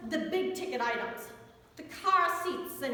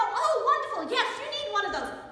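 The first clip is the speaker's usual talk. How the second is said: chirpy salesclerk cheer; 8 semitones higher, 4 dB louder than usual. Retold or imitated. Cheery clerk to baby customers.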